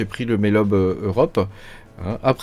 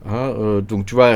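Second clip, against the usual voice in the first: ordinary talk, nothing marked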